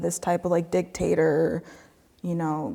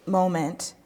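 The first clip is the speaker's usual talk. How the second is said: ordinary talk, nothing marked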